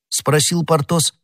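usual speaking voice

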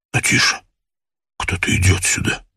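quiet, secretive alert; hushed warning. Acted. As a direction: warn him under your breath. Warns hidden chief someone comes.

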